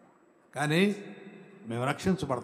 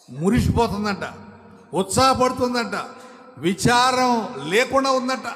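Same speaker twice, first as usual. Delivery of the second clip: rising higher and louder with each phrase; preaching build; 8 semitones higher, 6 dB louder than usual. Acted. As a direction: build the preaching toward a peak. Building sermon emphasis. (క్రమంగా పెరిగే బోధన స్వరం)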